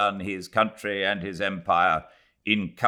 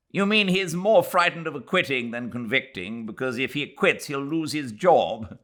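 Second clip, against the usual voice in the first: clever remark; 9 semitones higher, 4 dB louder than usual. Acted. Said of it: "with a clever little laugh".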